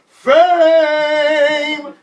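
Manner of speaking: long, high, very loud shout; crowd yelling. Retold or imitated. Crowd and band shouting the song's hook, loud yell. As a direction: bellow it like the whole crowd. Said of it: "the band and the crowd was yelling".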